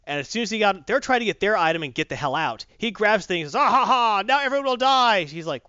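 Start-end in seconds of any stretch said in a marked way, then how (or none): 3.5-5.2 high, loud evil villain laugh and threat; villain gloat. Retold or imitated. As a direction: cackle it out like a cartoon villain. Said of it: "he goes all Thulsa Doom on him".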